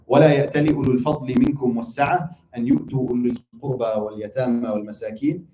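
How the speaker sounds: fast, chanted Arabic verse recital; Quran recitation. Acted. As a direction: recite the ayah in a flowing chant.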